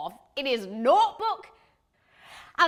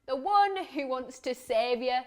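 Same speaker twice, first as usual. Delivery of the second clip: ordinary delivery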